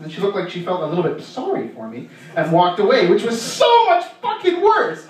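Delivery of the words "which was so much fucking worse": laughing. Real, not acted. Exasperated punchline, laughing.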